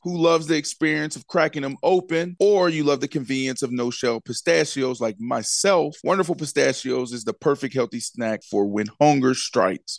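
smooth sponsor read, steady pace. Acted. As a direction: read it like a radio spot. Host reads sponsor ad.

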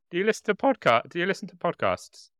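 hammed-up high re-enactment of the pitch. Host re-enacts cohost's Uber driver pitch, high and playful. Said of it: "re-enacting the Uber chat".

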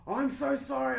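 high, gushing apology; apology mode. Retold or imitated. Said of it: "I went into apology mode".

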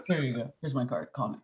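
a hint of a sketchy back-alley dealer; sketchy guru pitch. Offering a card as confidence guru, playfully sketchy. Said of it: "Sounded such a sketchy way".

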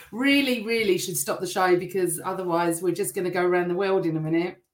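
usual speaking voice

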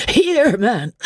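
high urgent cry; frantic urgency. A narrator's reading. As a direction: urge him on in a panic. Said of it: "stammer between two sneezes".

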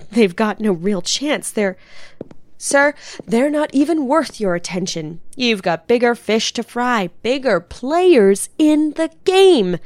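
rehearsed pitch to sir. Pretend. Rehearses call to superior, loud.

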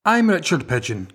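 ordinary talk

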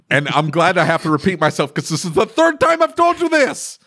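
rising to a high-pitched mock outburst; exasperated. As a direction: build to fed-up, drawn-up indignation. Acted exasperated talk to customer.

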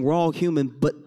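ordinary talk

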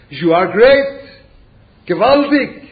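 loud self-flattery to a mirror; hammed-up loud praise to the mirror; mock self-praise. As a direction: boom it at yourself like a proud show-off. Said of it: "I stand in front of a mirror and I sell, say".

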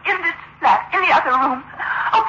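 normal talking voice

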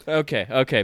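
usual speaking voice

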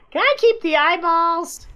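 put-on high, childlike pleading voice; kid voice. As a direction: ask it like an eager little kid. Quoting kid at dinner.

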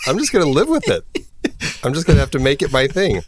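high, hammed-up Irish lilt, laughing it off; mock Irish accent. Host defending his accent, still in mock Irish. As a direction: own the bad Irish accent. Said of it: "it's the Irish".

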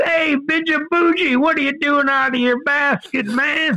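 high, easygoing mock-scolding voice; laid-back snake charmer. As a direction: chide the snake like a pal. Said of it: "And he said".

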